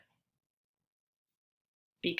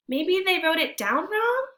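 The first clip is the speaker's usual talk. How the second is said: put-on hopeful boy; 11 semitones higher, 6 dB louder than usual. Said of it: "I say".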